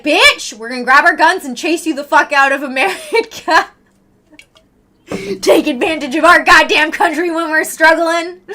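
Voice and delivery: mock outrage breaking into laughter; hostile American. American chasing Canadian bargain hunters out, hammed-up hostility. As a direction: play a worked-up local running someone off. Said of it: "I think Americans would get hostile".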